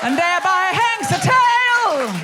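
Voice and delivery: loud, high, drawn-out declamation; slow, hammed-up shout; theatrical proclamation. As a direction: proclaim it like a grand stage punchline. Theatrical proclamation after audience reveal.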